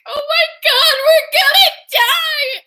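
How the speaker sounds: very high hammed-up panicked cry; mock panic. Girl found by the twins, hammed-up high panic. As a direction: shriek it in comic terror.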